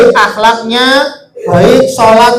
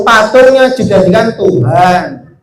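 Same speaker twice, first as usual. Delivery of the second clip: ordinary talk, nothing marked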